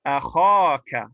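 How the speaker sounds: akhaka slow and loud, long aa on kha; long alif vowel. Shown as a demonstration. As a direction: stretch the kha in akhaka firmly. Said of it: "to make this mansub".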